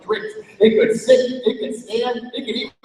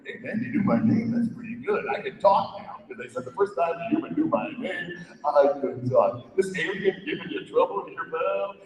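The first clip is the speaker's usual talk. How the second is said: soft, quick casual dog voice; a chummy streetwise dog; talking dog; 3 semitones lower, 8 dB quieter, faster than usual. Acted. Dog Goku offering Bob help, soft casual voice. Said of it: "The dog came over".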